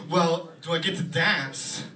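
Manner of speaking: slow, halting Walken question; Christopher Walken impression. Walken asking his agent about dancing, slow and halting. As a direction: drag it out with odd pauses. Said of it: "he goes".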